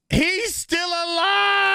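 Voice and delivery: high, slow blaring alarm call; mock alarm voice. The alive-meter blaring, high slow alarm. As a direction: wail it like a siren.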